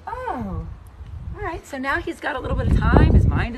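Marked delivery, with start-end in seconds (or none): 0.1-1.7 horse's voice. Pretend. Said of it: "And he's like".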